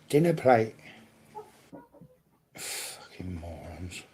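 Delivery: normal talking voice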